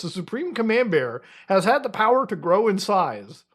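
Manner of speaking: grand, hammed-up announcer read; mock-heroic narration. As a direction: proclaim the silly legend as epic history. Reads bear legend in announcer style.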